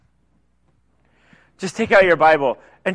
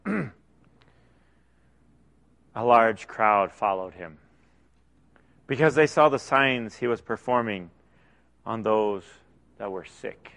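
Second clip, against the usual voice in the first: quiet scripture reading; 10 semitones lower, 8 dB quieter than usual. Acted. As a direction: read it alone at dawn in a still house. Reads verse in quiet morning.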